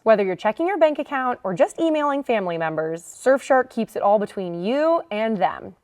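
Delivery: upbeat sponsor read. Acted.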